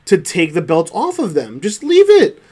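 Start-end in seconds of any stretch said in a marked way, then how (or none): 1.6-2.4 exasperated. Real, not acted. Exasperated rant about title booking.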